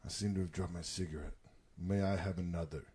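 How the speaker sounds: low, hushed, unbothered delivery; calm Bruce Willis. Retold or imitated. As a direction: play it utterly calm, very softly. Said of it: "Bruce Willis is just calm as fuck".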